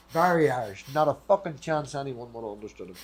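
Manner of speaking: ordinary talk, nothing marked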